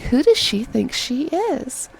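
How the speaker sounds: drawn-out indignation; annoyed. Retold or imitated. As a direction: demand it in exasperation. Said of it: "really annoyed me".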